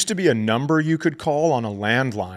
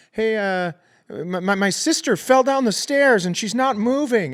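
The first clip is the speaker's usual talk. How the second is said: fake panicked kid; 10 semitones higher than usual. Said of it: "I was like".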